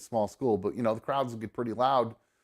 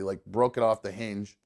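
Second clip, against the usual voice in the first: ordinary delivery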